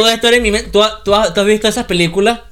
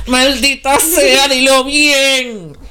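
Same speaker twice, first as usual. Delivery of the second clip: slow, high drawn-out exasperation; exasperated yell; 8 semitones higher, much slower than usual. Retold or imitated. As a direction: stretch it out in exasperation.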